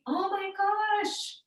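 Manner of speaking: very high, drawn-out astonishment; floored. Retold or imitated. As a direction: re-enact overwhelmed awe in a slow, high voice. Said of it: "I feel floored by it".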